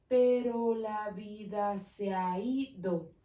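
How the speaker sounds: slow, each syllable separated; slow syllable count. Shown as a demonstration. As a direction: stretch "Pero la vida se ha ido" syllable by syllable. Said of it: "escucho ocho sílabas".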